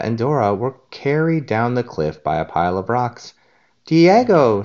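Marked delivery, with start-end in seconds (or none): 3.9-4.6 calling. A narrator's reading. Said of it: "said Dora as she pointed".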